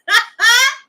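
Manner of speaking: loud, high-pitched laugh; real burst of laughter. Real, not acted. Laughs after retelling the exchange.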